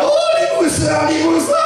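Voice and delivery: hammed-up crying for a dead husband; mock wailing. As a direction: wail it long and high, exaggerated. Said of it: "sekarang nangis juga".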